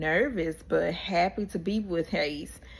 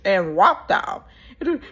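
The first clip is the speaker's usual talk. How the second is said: ordinary talk, nothing marked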